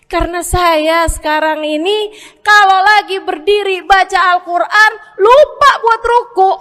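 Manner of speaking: loud, high, insistent pleading; emphatic instructions. Retold or imitated. Umar earnestly instructing the man, loud and pleading.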